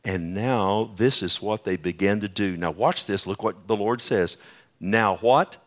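normal talking voice

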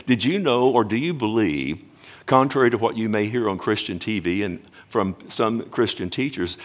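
ordinary talk, nothing marked

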